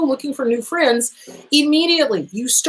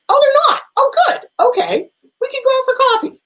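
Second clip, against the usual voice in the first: much higher, louder, bubbly relief; relieved inner voice; 4 dB louder than usual. Pretend. Sizing up a new acquaintance, high relieved chirp. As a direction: squeal it like you're delighted they passed the test. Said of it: "It's like, hmm".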